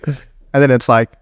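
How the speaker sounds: ordinary talk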